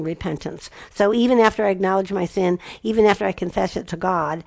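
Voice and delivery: ordinary talk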